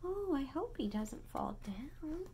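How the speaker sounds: gasped hushed worry; a touch of whispered alarm; mock worry whisper. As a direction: pretend to fret, whispering. Gasped worried aside.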